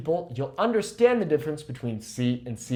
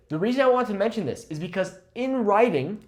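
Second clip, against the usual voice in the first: normal talking voice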